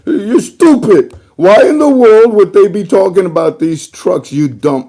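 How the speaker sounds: high, loud mock fury; hammed-up furious ranting; high, loud mocking tirade; mock angry rant. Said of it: "And he says".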